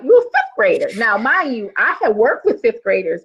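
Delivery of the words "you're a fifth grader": much higher, stunned exclamation; can't believe she's only a kid; shocked disbelief. Retold or imitated. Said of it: "I was shocked".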